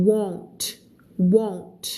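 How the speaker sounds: WON'T with a rounded O sound; rounder vowel. Shown as a demonstration. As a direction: say WON'T round and clear, ending in NT. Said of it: "เสียงมันจะกลมกว่า".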